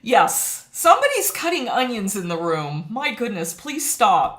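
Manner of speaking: loud, high playful protest; mock outrage. Streamer blaming onions for her tears, mock outraged. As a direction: call out in pretend indignation to cover tears. Joking excuse for tearing up.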